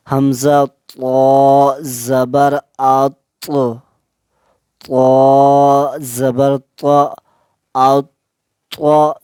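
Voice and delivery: slow spelling with each तो stopped and bounced; qalqala bounce. Shown as a demonstration. Teacher shows students how to read qalqala letters, bouncing stop. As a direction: hold the sound, then let तो pop off. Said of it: "इसको किस तरह पढ़ना है".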